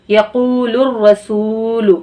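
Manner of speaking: यकूलुर रसूलु very slow, the long vowels stretched; slow Quranic recitation. Shown as a demonstration. Teacher slowly recites यकूलुर रसूलु for students. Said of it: "यहाँ पे भी हम खींचेंगे".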